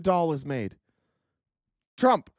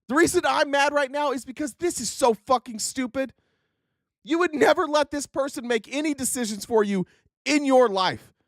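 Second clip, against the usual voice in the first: normal talking voice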